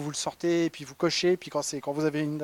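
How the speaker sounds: ordinary talk, nothing marked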